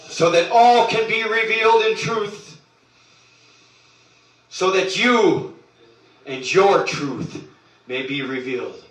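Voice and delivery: loud, rising sermon cry; preaching at full force. Acted. Preacher urging listeners to Mama God, full preaching. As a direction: proclaim it to the faithful, building.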